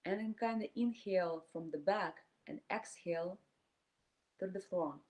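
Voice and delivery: calm meditation voice with long silent pauses; guided meditation. Acted. Teacher leading a group through inhale and exhale, guided meditation. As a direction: guide them breath by breath, leaving space between phrases.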